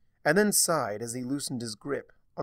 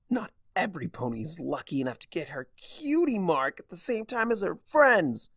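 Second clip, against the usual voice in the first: bitter retort rising to a louder, higher snap; snarl; 7 semitones higher, 4 dB louder than usual. A narrator's reading. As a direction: spit out the resentment. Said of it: "Starlight snarled".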